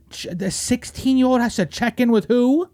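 ordinary talk, nothing marked

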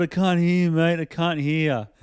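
loud, high shouting into phone; yelling over music. Retold or imitated. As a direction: shout over party noise. Plumber yelling over party music.

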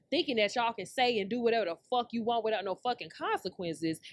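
usual speaking voice

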